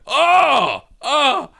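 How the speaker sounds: loud, very high-pitched cry; imitated shriek of disgust; horrified shock. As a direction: recoil with a big appalled "oh". Buddy sees what he is doing.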